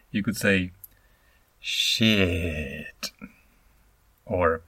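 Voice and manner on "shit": "shit" said low and slow, dragged out; low drawn-out shit. Shown as a demonstration. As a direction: let "shit" sink low and long. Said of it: "Let me illustrate just quickly".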